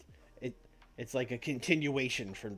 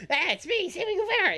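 high, bright-eyed wrestler intro; younger Chris Jericho voice; 4 dB louder than usual. Retold or imitated. First try at a Sammy Guevara impression, high and hammed-up. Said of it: "just do your Chris Jericho, but younger".

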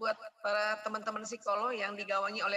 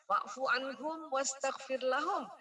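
slow chanted Arabic; Quran recitation; slower than usual. Acted. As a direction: recite it slowly and reverently.

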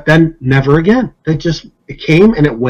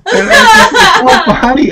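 high, words squeezed through laughter; laughing. Real, not acted. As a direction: talk while still cracking up. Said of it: "I almost wet my pants laughing".

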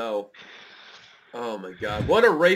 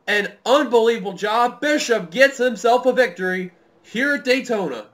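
raised-pitch victory recap; excited play-by-play; 7 semitones higher, 8 dB louder than usual. Real, not acted. Commentator recapping the winning pass, excited play-by-play. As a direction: still buzzing from the finish.

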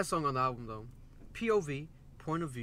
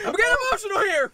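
high, loud laugh while admitting tears; embarrassed laughing confession; laughing through emotion; 9 dB louder, faster than usual. Real, not acted. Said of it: "Damn, why am I getting emotional?".

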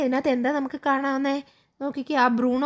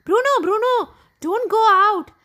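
high, loud call after a runaway puppy; shouted warning; 8 semitones higher, 5 dB louder, much slower than usual. A narrator's reading. Sachin shouts to his puppy Bruno at the open gate, high urgent shout. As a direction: shout it out, pitched way up. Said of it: "Sachin shouted".

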